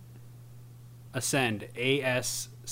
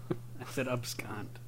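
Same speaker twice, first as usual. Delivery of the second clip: whispering; 10 dB quieter, slower than usual. Real, not acted. Correcting the pronunciation quietly.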